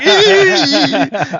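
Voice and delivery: high squeal with a laugh. Retold or imitated. Reliving the jump scare, high laughing squeal. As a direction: squeal in shock. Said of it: "I was like".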